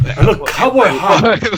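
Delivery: laughing cowboy voice; cowboy line through a laugh; laughing character voice. Acted.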